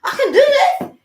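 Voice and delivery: psyching himself up, growing bolder; triumphant. Retold or imitated. Retelling pushing the veil up, triumphant rising cry. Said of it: "I was like".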